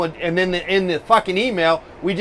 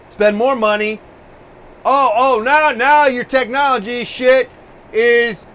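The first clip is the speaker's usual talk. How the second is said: mocking rant; 4 semitones higher, 4 dB louder than usual. Mocking tech companies' upselling.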